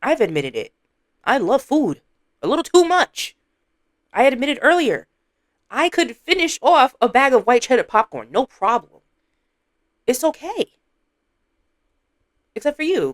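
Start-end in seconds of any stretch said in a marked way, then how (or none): 1.2-13.1 teasing. Real, not acted. Teasing friend, high mock confession.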